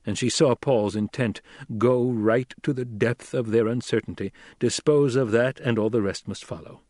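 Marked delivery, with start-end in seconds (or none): none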